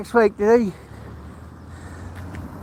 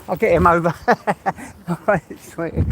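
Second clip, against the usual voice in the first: usual speaking voice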